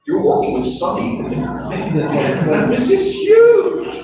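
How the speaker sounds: breaking into a laugh, building to a high shout on huge; thrilled, voice cracking up high; excited. Retold or imitated. Telling a colleague he works with Sonny, excited high shout.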